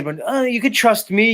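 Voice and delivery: high mocking imitation of a woman; mocking high voice of a woman promising trust; mock woman's voice. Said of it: "these women are gonna say".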